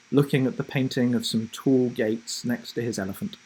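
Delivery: ordinary delivery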